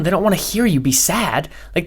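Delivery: ordinary talk, nothing marked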